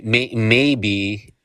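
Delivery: ordinary delivery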